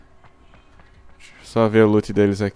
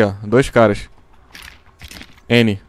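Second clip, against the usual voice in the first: ordinary talk, nothing marked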